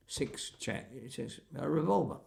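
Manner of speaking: usual speaking voice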